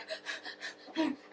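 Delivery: sharp whispered gasp for air; breathless gasp. Retold or imitated. The girls surface from the shark fight, a sharp whispered gasp. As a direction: gasp as you break the surface.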